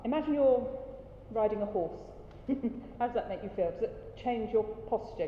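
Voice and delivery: normal talking voice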